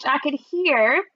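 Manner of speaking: plain reading voice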